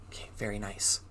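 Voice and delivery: ordinary delivery, nothing marked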